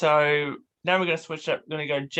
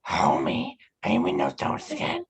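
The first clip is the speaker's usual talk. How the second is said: much lower, raspy cartoon mom; Marge Simpson impression; 12 semitones lower than usual. Shown as a demonstration. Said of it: "I'm gonna do a voice impression".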